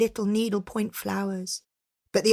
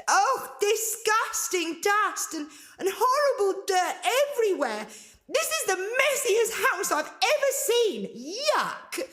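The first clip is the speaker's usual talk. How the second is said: high, shrill-edged disgusted outburst; indignant cry; 14 semitones higher than usual. Acted. Girl disgusted by the ogress's house, high cry. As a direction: squeal it out in girlish disgust. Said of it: "Angelina cried".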